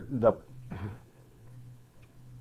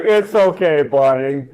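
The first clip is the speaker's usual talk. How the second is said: hammed-up soothing, a bit higher and louder; mock comforting; 3 semitones higher, 4 dB louder, slower than usual. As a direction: pretend to console the dog, half laughing. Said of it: "I'm like".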